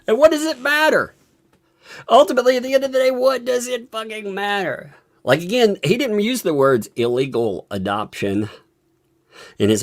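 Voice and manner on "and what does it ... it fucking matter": high-pitched, exasperated questioning. Real, not acted. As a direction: demand it in fed-up disbelief.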